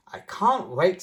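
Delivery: bursting with eagerness; excited little pig; eager child. Acted. Peppa eager for school.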